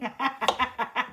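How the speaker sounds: long helpless giggle; breathy trailing laughter; laughing. Real, not acted. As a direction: laugh it out at your own joke. Laughs at her own question.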